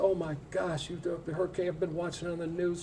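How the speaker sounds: high, rushed imitation of a panicked mother-in-law; mock frantic. Mother-in-law on the phone after the hurricane, imitated frantic and high. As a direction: play her worried sick, hammed-up. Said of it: "She's frantic".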